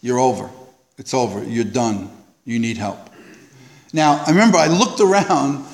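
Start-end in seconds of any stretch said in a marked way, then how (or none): 0.0-3.0 low, soft, grave voice; quiet stern inner voice. Retold or imitated. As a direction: voice in his head, lower and softer. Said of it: "the voice said".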